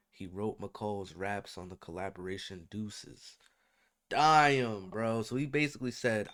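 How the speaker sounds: ordinary talk, nothing marked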